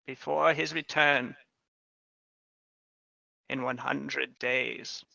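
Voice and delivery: lightly voiced witch giving a warning; a hint of a solemn witch; meaningful witch. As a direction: deliver it as the witch, with weighty pauses. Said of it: "She says, looking at Sadako meaningfully".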